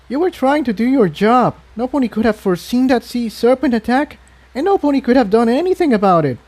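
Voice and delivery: high, tender pony voice, hurrying on; high sincere filly consoling her friend; comforting filly. Acted. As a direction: reassure him quickly in a small high voice. Tiptoe reassures Jigsaw.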